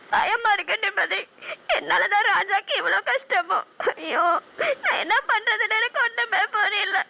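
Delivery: weep it out, lost and scared; crying. Acted. Crying to her friend on the phone about the arrest, tearful. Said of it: "please அழாதீங்க".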